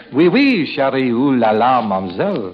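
put-on French accent. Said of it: "now you're talking my language".

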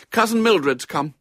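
grown-up sister voice; older sister. A narrator's reading. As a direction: announce the visitor to your brother. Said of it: "continued his sister".

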